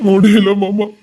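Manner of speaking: loud, helpless sobbing plea; crying. Acted. Nephew telling uncle he cannot bear it, sobbing. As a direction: break down completely, words swallowed by sobs. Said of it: "அழாத".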